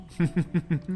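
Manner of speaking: a sly gloating chuckle; creepy laugh. Acted. Villain laughs at victim.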